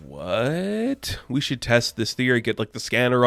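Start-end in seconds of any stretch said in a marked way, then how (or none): 0.1-1.2 long, drawn-out rising what; higher-pitched mock disbelief, sliding upward; incredulous. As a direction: stretch it into a steep questioning climb. Judge stunned by second Bentley claim.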